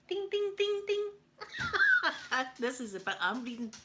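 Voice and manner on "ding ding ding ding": high, ringing slot-machine bell; ding like a machine hitting the jackpot; quick high-pitched dings. Acted. Jackpot bell imitated, hitting a bad word.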